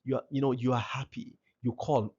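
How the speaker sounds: normal talking voice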